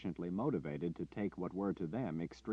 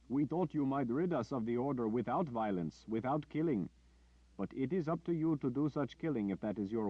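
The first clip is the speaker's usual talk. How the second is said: high, firm pleading voice; stubborn villager; 5 semitones higher than usual. Acted. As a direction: place the duty on him. Villager refuses to kill.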